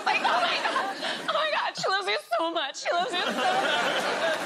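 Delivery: very high, hammed-up blubbering; mock tearful outburst. Mother overwhelmed as the star greets her child, mock weeping. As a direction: wail it high as if overcome, playing it for laughs. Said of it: "And I burst into tears".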